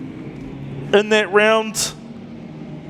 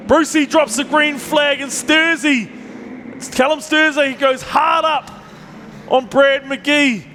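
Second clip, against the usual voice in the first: higher, rising race call as the flag drops; excited race call; 6 semitones higher than usual. Real, not acted. Commentator calling the green flag to the crowd, excited and higher. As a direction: call the start like the cars are off the line. Race start called live.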